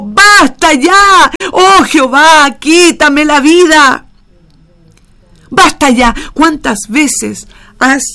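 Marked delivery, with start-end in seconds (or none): 0.0-6.1 slow, loud, high cry of despair; raised anguished plea. Acted. Elijah crying out to God in the desert, slow and anguished. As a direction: beg loudly and slowly to be released. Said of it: "alzó su voz y dijo".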